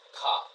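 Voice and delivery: "car" ending on an open ah with no R; non-rhotic pronunciation. Shown as a demonstration. Teacher repeats the British way, "car" without its R. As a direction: leave the R off "car". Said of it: "We don't say the R".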